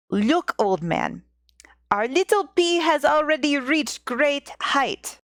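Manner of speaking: hammed-up Russian accent, slow at first; a jokey Russian accent for the old woman; terrible Russian accent. Old woman marvelling to her husband, in a mock Russian accent. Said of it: "insert my terrible Russian accent".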